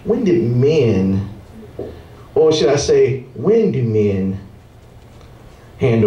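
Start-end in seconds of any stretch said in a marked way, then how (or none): none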